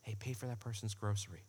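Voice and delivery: very soft, low inner voice; a hushed nudge from inside your head; still small voice. Acted. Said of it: "It's a still small voice".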